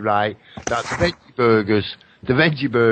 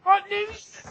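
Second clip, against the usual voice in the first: high squeaky mock question, soft; a squeaky imitation of a confused guest; mock squeaky reply; 4 dB quieter than usual. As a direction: squeak it like a baffled listener.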